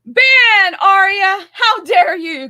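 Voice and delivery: loud, high, hammed-up indignation; mock outrage. Co-host playfully scolds Arya in loud mock outrage. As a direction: bellow it in fake shock, way up high.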